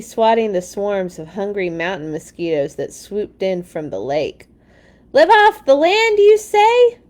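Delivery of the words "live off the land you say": high, loud indignant shout. A narrator's reading. Mama yelling at Papa while running from mosquitoes, loud high shout. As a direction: yell it out, much higher and louder. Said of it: "shouted Mama".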